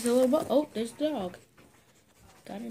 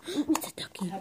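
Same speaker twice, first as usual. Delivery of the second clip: whispering; 6 semitones higher, 6 dB quieter than usual. Real, not acted. Showing duck to toddler.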